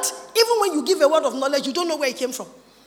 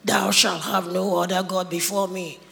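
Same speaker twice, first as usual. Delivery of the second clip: movie God voice; 9 semitones lower than usual. Retold or imitated. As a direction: mimic the epic film voice of God. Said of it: "they mutilated the voice and add all the after effects".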